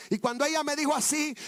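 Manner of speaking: ordinary talk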